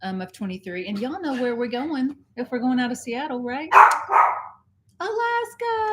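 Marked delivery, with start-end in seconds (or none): 5.0-5.9 high-pitched sing-out of the destination; excited, high-voiced announcement; gleeful reveal. Real, not acted. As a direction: sing it out high like a big surprise.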